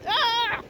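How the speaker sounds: short high yelp; high "ah" of discomfort; cold-water yelp. Real, not acted. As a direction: cry out from the cold. Just out of freezing water.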